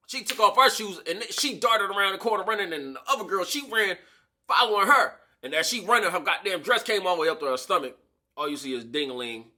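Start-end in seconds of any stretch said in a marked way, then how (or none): none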